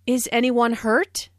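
yes-no question rising on "hurt"; mildly worried, "hurt" going up. Shown as a demonstration. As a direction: lift the pitch at the end on "hurt". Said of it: "intonation rises at the end".

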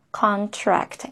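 contract, the tr said clearly. Shown as a demonstration. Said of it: "this is tra".

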